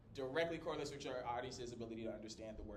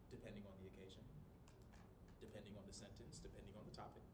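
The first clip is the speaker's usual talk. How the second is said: low, soft demonstration; lower volume; 6 semitones lower, 12 dB quieter, faster than usual. Shown as a demonstration. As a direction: drop the voice low and quiet. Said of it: "you might go for the lower volume".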